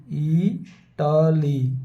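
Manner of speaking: इटाली dragged out, every syllable held long; very slowly drawn out. Shown as a demonstration. Teacher repeating the word for students, very slowly. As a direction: stretch इटाली out for students to hear each sound. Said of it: "इसी तरह इटली".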